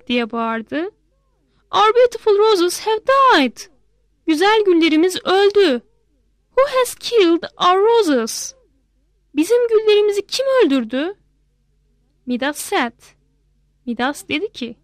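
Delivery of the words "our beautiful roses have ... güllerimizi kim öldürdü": put-on high, tearful child's voice; a little girl grieving her roses; upset little girl. Girl mourns dead roses.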